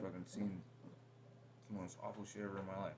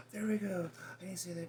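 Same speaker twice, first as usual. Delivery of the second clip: high, louder mocking whine; mocking partner; 9 semitones higher, 5 dB louder than usual. Mocking partner's overreaction to her face, high and louder. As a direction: parrot her back, pitched way up. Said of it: "jumping to conclusions, going".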